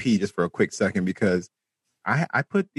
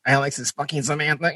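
robot voice; 6 semitones higher than usual. Retold or imitated. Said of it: "He's like".

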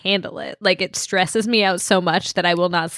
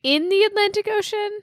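incredulous; 13 semitones higher than usual. Real, not acted. Incredulous repeat of quiz question.

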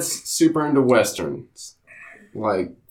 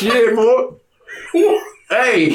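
improv of his dad; 8 semitones higher, much slower than usual. Retold or imitated. Host imitating his drunk dad at a cohost, high laughing holler. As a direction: holler it like a tipsy country father. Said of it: "improv of my dad, Walt".

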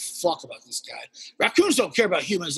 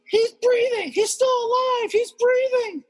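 much higher and louder, thrilled; excited neighbor; 3 dB louder than usual. Retold or imitated. Neighbor announcing the raccoon lives, high and thrilled. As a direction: squeal the good news. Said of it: "He goes".

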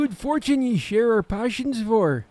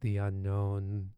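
ominous narration; 8 semitones lower, 7 dB quieter than usual. Acted. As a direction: speak it low like a dark secret. Ominous intro over music.

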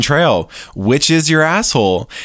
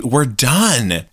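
ordinary delivery

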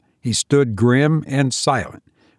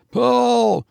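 high urgent shout of pull; desperate shouted command; 11 semitones higher than usual. A narrator's reading. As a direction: yell it up to the man below. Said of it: "shouted George".

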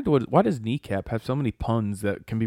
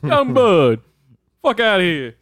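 higher and louder, dismissive; tough street voice; 8 semitones higher, 6 dB louder than usual. Pretend. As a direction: brush them off hard. Voicing bee, higher louder.

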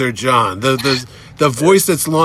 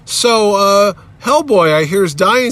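higher, slow, hesitant question; awkward opener; 7 semitones higher, 3 dB louder, slower than usual. Retold or imitated. Interviewer sits down and asks Mignola, mock tentative. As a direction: venture it slowly in a raised voice.

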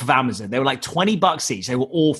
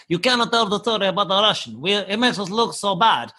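higher-pitched mock Russian accent; 4 semitones higher than usual. Russian investors begging him not to tell the story, Russian accent. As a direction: imitate worried Russian investors, thick accent. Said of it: "my V Cs are Russian".

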